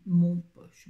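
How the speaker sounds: "Mon poche" with "mon" loud and punched; repeating the title with a strong hit on "mon"; stress on Mon poche. Shown as a demonstration. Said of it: "qu'est-ce que j'ai dit".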